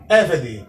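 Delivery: Eved stressed at the start, then falling away; stress on first syllable. Shown as a demonstration. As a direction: demonstrate Eved as EH-ve-de, hitting EH. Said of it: "pois a sílaba tônica está no Ai". (Eved, acento forte na primeira sílaba)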